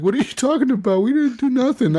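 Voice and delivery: high, whiny mock innocence; imitated baffled denial. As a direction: protest as the confused victim. Retold confused denial.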